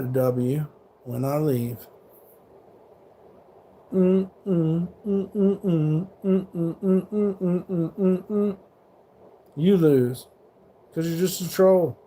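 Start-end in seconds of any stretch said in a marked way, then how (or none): 3.9-8.6 mocking hum. Real, not acted. Mocking a troll after a comeback.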